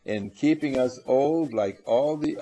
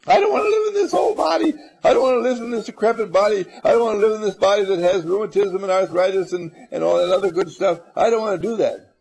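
fast, loud, much higher whining shout; mock complaining shout; 7 semitones higher, 6 dB louder, much faster than usual. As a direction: wail about the decrepit body. Acted complaint about old body.

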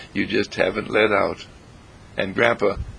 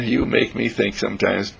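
plain reading voice

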